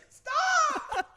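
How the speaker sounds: very high, loud shriek; hammed-up panicked yell; mock scream. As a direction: scream as if to halt a beating. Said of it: "I couldn't be like".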